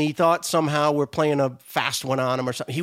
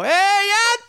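high, loud call out a window; mom yelling out window; 5 dB louder than usual. Retold or imitated. Italian mom calls her son from the window, high yell. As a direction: call your boy home from the window. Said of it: "his mom would yell out the window".

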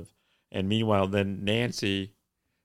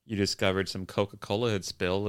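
ordinary talk

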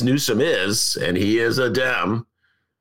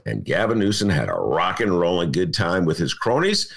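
ordinary talk, nothing marked

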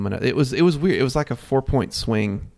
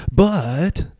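raised, louder pundit voice; mock sports-show hype; 6 semitones higher, 6 dB louder than usual. Co-host mimics shows teasing Kansas's chances, mock hype. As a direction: hype it up like a breathless TV tease. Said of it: "tidbit in on all the shows where you see".

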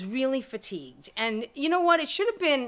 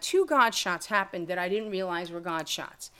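normal talking voice

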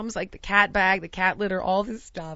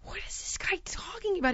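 hushed, incredulous aside; whispered mock disbelief; baffled whisper; 9 semitones higher, 7 dB quieter than usual. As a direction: whisper it as a private bewildered thought. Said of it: "And I was like".

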